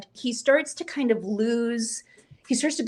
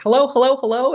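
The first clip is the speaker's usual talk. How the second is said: loud, slightly higher repeated calling; frantic film-scene impression; 4 semitones higher, 9 dB louder than usual. Catherine O'Hara's Home Alone phone scene, loud insistent hellos. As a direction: call urgently down a phone line, imitated. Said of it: "that scene where she's like".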